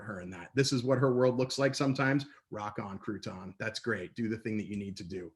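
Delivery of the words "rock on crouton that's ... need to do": normal talking voice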